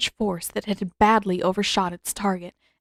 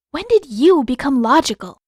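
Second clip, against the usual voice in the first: teasing; 6 semitones higher, 8 dB louder than usual. A narrator's reading. Katara teasing Zuko.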